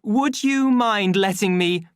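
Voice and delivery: high, loud, polite appeal cut short; uncertain smile. A narrator's reading. Official asking to carry on, uncertain politeness. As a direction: interrupt with nervous courtesy. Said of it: "with an uncertain smile".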